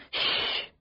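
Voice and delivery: sharp whispered shush; hissed hush. Acted. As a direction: hush the screaming child with a hiss. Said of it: "hissed the woman above me".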